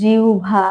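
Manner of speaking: "জিহ্বা" as jiu-bha, with a glide; correct pronunciation. Shown as a demonstration. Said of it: "সেটা জিহ্বা".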